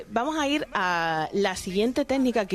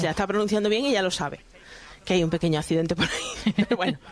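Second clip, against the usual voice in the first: ordinary delivery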